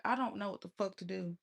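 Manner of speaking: ordinary talk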